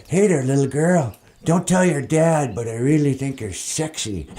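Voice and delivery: creepy voice. Retold or imitated. Creepy guy voice acted out.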